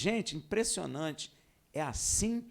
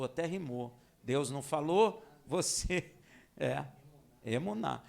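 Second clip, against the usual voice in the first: ordinary talk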